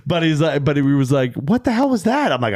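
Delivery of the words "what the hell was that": much higher, incredulous; baffled. Retold or imitated. Friend asking the comic after the rude remark, baffled and high. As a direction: ask it stunned.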